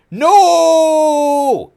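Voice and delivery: high piercing scream. A narrator's reading. Villain screaming as he's stabbed, piercing. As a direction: shriek it at the top of the range. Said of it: "he screamed".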